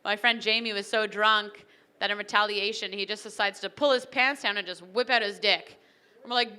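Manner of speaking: normal talking voice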